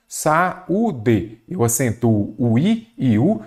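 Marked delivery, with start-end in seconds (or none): 0.1-1.4 "saúde" split sa-ú-de, each vowel separate; syllable by syllable. Shown as a demonstration. Teacher giving an example of hiato, saúde split by syllable. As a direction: pull the A and Ú of "saúde" apart. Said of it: "quando separamos em sílabas".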